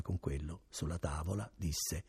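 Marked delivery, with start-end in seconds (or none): none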